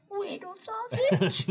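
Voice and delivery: very high, slow, soft squeak; tiny cutesy questioning falsetto; future hire's impression. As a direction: squeak out "Whittle Sausage?" slowly and quietly. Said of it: "they're just gonna go".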